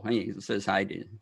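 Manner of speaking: ordinary talk, nothing marked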